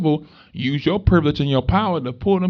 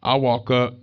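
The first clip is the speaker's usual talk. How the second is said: ordinary talk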